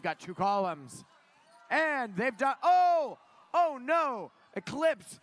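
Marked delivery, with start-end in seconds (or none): 1.7-5.2 high, loud "oh no" from the commentary box; dismayed commentary. Real, not acted. Sudden mishap during live match commentary.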